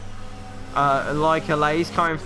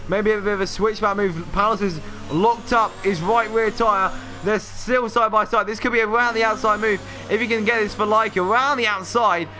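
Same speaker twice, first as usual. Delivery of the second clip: higher, fast-building play-by-play; excited race commentary; 5 semitones higher than usual. Acted. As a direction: call the overtake as it happens.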